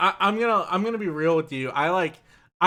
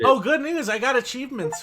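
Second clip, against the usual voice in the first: excited; 9 semitones higher, 3 dB louder than usual. Real, not acted. Sudden discovery of achievements.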